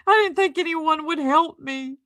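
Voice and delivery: high, hammed-up mock sob; mocking imitation. Critic parodies her tearful thanks, high mock cry. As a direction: sneer her line in a high teary voice. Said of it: "She's crying like".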